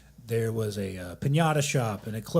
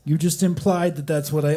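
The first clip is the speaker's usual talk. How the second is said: raised, louder mock indignation; mock angry rant; 5 semitones higher, 5 dB louder than usual. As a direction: rant it like you're fed up. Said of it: "I'm gonna say it a lot because I'm mad".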